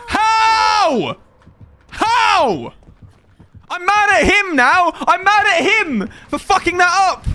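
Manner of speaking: high, loud, hammed-up outrage; mock anger. Streamer egging on anger for content, hammed-up high shout. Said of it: "Today's stream gimmick is getting angry".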